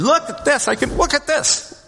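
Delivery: high, slow, delighted exclamation; excited healed man. Retold or imitated. Healed man telling a crowd, excited and rising high. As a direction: show off healed legs in wonder. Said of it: "would be excited".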